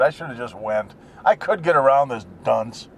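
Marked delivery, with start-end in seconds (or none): 0.0-0.9 softer, lower grumble; quiet self-reproach; muttered regret. Real, not acted. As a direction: mutter it to yourself. Muttered aside.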